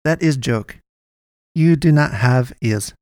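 stilted, broken-English character voice; odd little medic's voice. A narrator's reading. Miguel teases his patient after the ear test, stilted. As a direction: deliver the joke in stilted foreign-sounding English.

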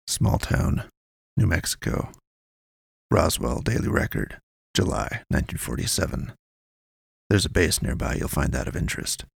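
lightly voiced, low and hushed informant; cagey informant. A narrator's reading. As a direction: pass on the tip discreetly, in fragments.

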